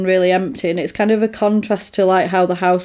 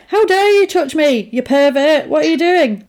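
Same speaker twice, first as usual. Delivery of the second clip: mock outrage; 7 semitones higher, 3 dB louder than usual. Outraged woman retold.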